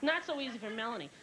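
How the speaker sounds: usual speaking voice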